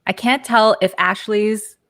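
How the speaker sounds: usual speaking voice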